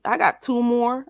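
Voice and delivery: ordinary delivery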